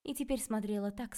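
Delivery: usual speaking voice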